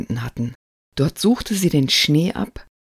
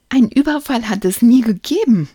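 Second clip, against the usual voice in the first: put-on high, cheeky child's remark; grinning; 7 semitones higher, 6 dB louder than usual. A narrator's reading. Lukas solves the case, grinning. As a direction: say it through a grin, voice lifted. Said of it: "ergänzte Lukas grinsend".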